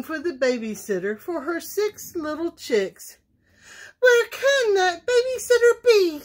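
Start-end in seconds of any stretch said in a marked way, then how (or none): 4.0-6.2 high, loud fretful hen voice; a much higher, louder anxious chicken; fussing. A narrator's reading. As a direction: fuss like a flustered mother hen. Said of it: "she fussed".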